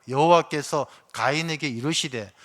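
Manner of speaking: ordinary talk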